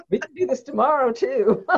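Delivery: said through laughter; laughing kids. Retold or imitated. Sisters telling mom they'd eat it again, laughing. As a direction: giggle it out like a happy kid. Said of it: "My sister and I were like".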